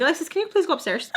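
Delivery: high, rushed mom at her limit; exasperated plea. Retold or imitated. Mom sending the mouthy guest away, exasperated.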